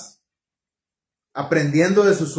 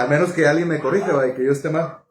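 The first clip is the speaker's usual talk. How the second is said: ordinary delivery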